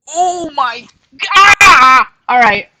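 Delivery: high, loud alarmed scream; startled shout. Real, not acted. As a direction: shriek while falling over. Slips and falls mid-stretch.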